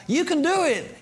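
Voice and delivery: high-pitched mock cheerleading; squeaky-high mock encouragement; goofy pep talk. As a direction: parody a goofy motivational slogan. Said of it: "loads of goofy, kinda worldly".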